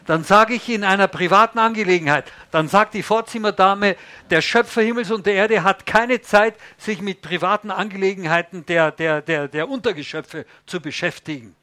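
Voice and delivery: loud, high, haughty refusal; a haughty receptionist turning someone away; mock indignant secretary. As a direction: brush off the caller loudly. Preacher acts out phone call.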